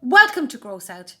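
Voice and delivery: loud, high TV host screech; a loud, high screech to open the show; screeching presenter. A narrator's reading. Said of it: "screeched the presenter".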